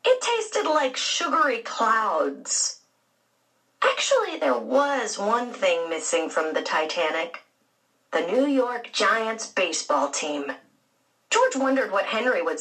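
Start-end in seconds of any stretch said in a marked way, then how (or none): none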